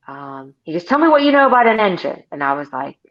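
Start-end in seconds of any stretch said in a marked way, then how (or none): 0.8-2.2 imitating a demanding salesman, high and fast; mock demanding quiz. Veteran salesman testing new kid on engines, mock demanding. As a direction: rattle off a challenge, pitched up.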